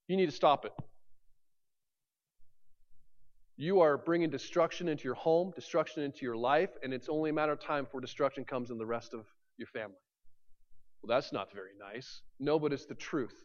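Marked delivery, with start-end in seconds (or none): 0.1-10.0 quiet stern warning. Retold or imitated. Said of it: "I say". 11.0-12.2 much softer, lower offended reply; offended murmur. Retold or imitated. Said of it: "imagined reply".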